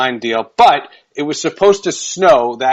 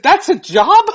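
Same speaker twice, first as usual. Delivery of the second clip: amazed; 12 semitones higher, 4 dB louder than usual. Retold or imitated. Retold amazement at the job.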